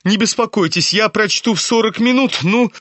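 high-pitched, feverish address to the crowd; excited cry. A narrator's reading. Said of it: "крикнул он, смеясь своим странным смехом". (возбуждённо, высоким голосом)

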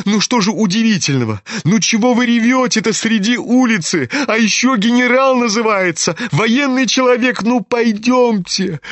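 put-on high, hurried boyish scolding; exasperated teenage son. A narrator's reading. Kolya scolds his weeping father, exasperated.

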